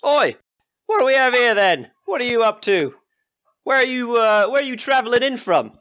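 high, brash country villager; rough villager. Acted. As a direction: greet travellers as a nosy local. GM voices a villager.

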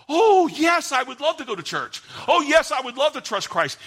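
high, loud, overeager yes; mock eager convert. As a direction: mimic a too-keen believer. Mocking eager convert in sermon.

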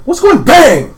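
high, loud cry and bang; child's question cut off by a shouted gunshot; startled child. Acted.

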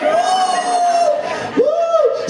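very high, long whooping cry; hammed-up squeal of amazement; acted joyful whoop. Hears more visitors came.

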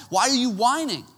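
high, incredulous parental question; exasperated dad. Retold or imitated. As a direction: imitate a dad fed up with whining. Dad questions whining son.

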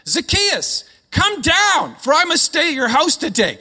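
high, loud call up to someone. Acted. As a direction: shout it up into a tree. Jesus calls up into the tree.

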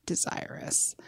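breathy whisper of desirous. Acted. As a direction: whisper it seductively. Whispered word in reading.

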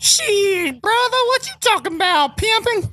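high falsetto sassy woman; man doing a woman's voice. Pretend. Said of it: "I'll be the girl voice".

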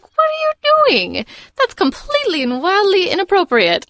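scandalized, high-pitched outrage; loud mock indignation, voice leaping up high; Cogsworth. Host imitating a very proper character appalled at what's happening, scandalized. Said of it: "kind of the vibe that I get from Cogsworth".

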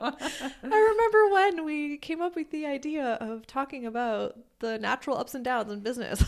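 high, loud hammed-up reminiscing; mock nostalgic. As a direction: ham up the fond memory. Playing along with nostalgia joke.

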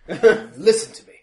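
loud, slow, high-pitched order; blustering bully taking command; angry demand. Acted. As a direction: demand their attention forcefully. Washington confronts the speaker.

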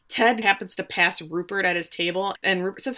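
usual speaking voice

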